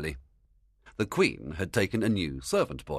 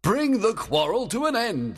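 raised, forceful royal demand; Oberon; 5 dB louder than usual. A narrator's reading. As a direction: order her as an impatient king. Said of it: "Oberon said".

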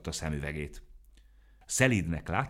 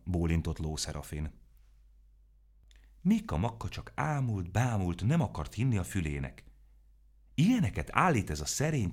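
ordinary delivery

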